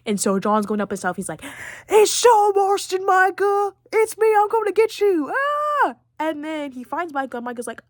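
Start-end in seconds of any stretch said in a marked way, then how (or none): 1.9-5.3 mock battle shout. John calls out to Micah on the mountain, hammed-up shout. 5.3-5.9 short, very high hammed-up yell; high mock battle cry. John charging up the mountain at Micah, high mock battle cry.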